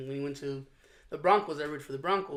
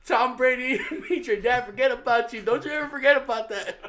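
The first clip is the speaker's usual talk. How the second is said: higher, louder, laughing mock warning; laughing tease; 6 semitones higher, 6 dB louder than usual. Uncle telling niece her dad forgot her, laughing. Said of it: "He's like".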